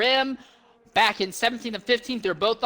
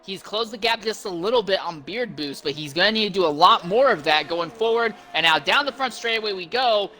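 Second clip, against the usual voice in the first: normal talking voice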